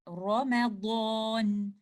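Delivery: Ramadan drawn out slowly with a thick 'raw' at the start; slow model of Ramadan, full-mouthed R; heavy ra demonstration. Shown as a demonstration. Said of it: "with thickness and with full mouth".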